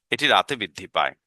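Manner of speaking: usual speaking voice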